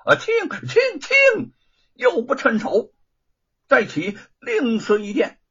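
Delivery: very high, loud dissatisfied exclaiming; Monkey King. A narrator's reading. Monkey King finding the fork too light, high loud complaint. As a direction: squawk the complaint, then ask again briskly.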